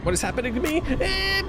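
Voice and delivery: squeaky voice, rising at the end; squeaky high voice. Pretend. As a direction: ask it in a squeaky voice. High voice, then plain repeat.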